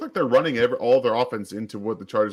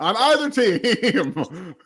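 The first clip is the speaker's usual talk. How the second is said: laughing; 9 semitones higher, 3 dB louder, much slower than usual. Pretend. Repeats the boast slowly, laughing.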